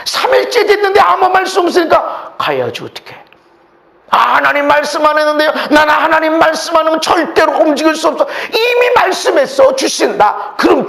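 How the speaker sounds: shouted preaching. Acted. Preacher describing the mocking at Jericho to the church, shouted preaching. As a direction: preach it full out, building.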